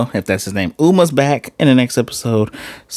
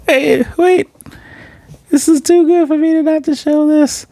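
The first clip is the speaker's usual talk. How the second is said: excited high-pitched rant. Real, not acted.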